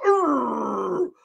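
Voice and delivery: long throaty "urgh"; comic gag from a throat poke; imitated choking gurgle. Donna poked in her throat, imitated gurgle.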